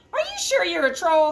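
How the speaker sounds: high mocking schoolmate taunt; teasing. Classmates at troll school teasing the gentle troll, mocking. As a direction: tease him like a smug classmate. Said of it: "the other students teased".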